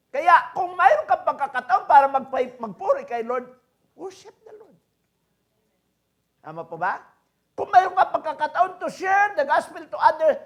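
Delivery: loud, high preaching voice. Acted. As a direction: preach it out to the congregation. Preaching at full force. (malakas na pangangaral)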